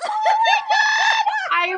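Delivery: high, slow laughing shriek of disbelief; delighted laughing disbelief. Real, not acted. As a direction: stretch it out through a big laugh. Stunned by shared list item.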